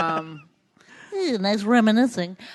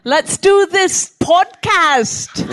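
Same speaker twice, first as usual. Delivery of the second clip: slow, higher and louder Jamaican voice; Jamaican accent; 8 semitones higher, 7 dB louder, much slower than usual. Pretend. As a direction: stretch it out in a hammed-up Jamaican accent. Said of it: "now that's a Jamaican".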